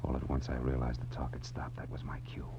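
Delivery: soft hushed whisper; hushed noir narration. A narrator's reading. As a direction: tell it like you're hiding by the door. Narrator sneaking up, listening.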